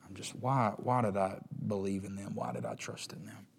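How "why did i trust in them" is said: hushed rueful whisper; preacher voicing quiet shame, whispered. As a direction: breathe it out as private regret. Inner regret voiced.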